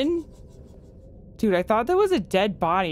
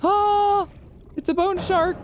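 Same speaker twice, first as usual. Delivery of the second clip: high, loud startled yelp; startled cry; 13 semitones higher, 4 dB louder than usual. Real, not acted. Diver spotting a bone shark, high startled cry. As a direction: jolt at the sight of the shark.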